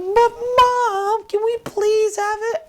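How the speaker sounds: very high put-on child voice; whiny drawn-out mock begging to mom; pleading kids. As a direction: imitate kids wheedling for a dog. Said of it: "And they're like".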